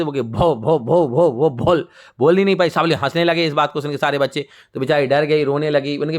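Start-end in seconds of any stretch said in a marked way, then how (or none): 0.5-1.9 stuck repeating "भो", never finishing the name; stammering. Acted. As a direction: stammer on "भो" in a wavering voice. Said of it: "अब वो हकलाती थी".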